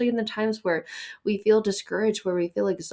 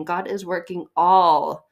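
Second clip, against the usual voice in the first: "God is working all," slow, louder, weight on "all"; stressed, slow; 5 dB louder, slower than usual. Shown as a demonstration. Preacher driving home the verse, slow and louder. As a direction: drag it out and press "all". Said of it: "the emphasis on the key words".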